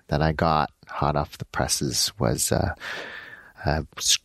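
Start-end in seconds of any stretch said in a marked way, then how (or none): none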